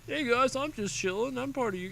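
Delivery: high, goofy voiced soldier unit; Marine voice. Caster voicing a lone Marine unit, high goofy soldier voice. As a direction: voice the little Marine like he's just chilling. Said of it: "I was trying to do a Marine voice there".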